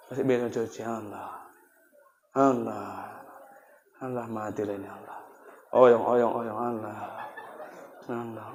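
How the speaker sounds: low, soft, weak groans; sick man groaning. Acted. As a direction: moan like a husband sick in bed. (suami sakit mengerang pelan)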